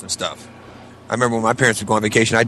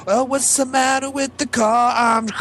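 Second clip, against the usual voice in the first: high, slow put-on voice; mock tape voice; slower than usual. Recalling a taped bit, in a high, slow put-on voice. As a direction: do a silly voice from the tape.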